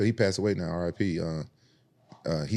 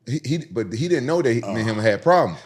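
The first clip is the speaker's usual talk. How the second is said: ordinary talk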